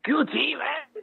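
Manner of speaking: high, hammed-up Mexican accent. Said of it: "How do you say Q T, uh, in a Mexican accent?".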